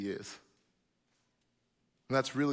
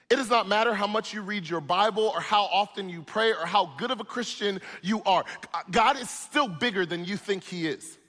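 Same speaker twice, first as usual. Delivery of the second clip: rising preacher's cry, louder and higher; preaching build; 6 semitones higher, 5 dB louder than usual. Acted. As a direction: build it up to a ringing climax. Preaching builds to full force.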